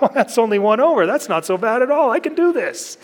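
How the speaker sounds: high, fast, relieved mock cheer; relieved excitement. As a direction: brighten up like a kid who found his way. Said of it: "I'm like".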